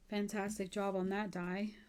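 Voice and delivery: ordinary delivery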